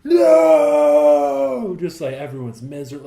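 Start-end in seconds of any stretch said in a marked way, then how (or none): 0.0-1.8 a huge high wail of despair; anguished cry. Retold or imitated. Crowd voiced in despair at the party ending, screaming high. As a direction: howl the no like the world is ending. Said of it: "And it's like".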